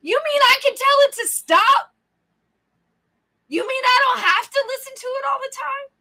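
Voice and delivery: high, loud incredulous questions; astonished realization. Retold or imitated. Reader reliving the first time she read this, astonished. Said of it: "Like, the first time I read this".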